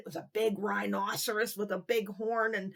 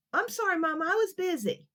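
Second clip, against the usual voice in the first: lightly voiced sheepish boy; a small boy half-sorry; little boy Kevin; 9 semitones higher, 3 dB louder than usual. Kevin apologizing to Mommy, lightly voiced kid.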